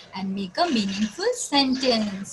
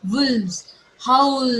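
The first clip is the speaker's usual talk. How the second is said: normal talking voice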